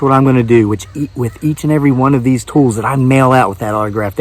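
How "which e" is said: ordinary delivery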